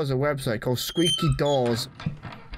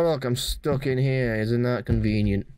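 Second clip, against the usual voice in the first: ordinary talk, nothing marked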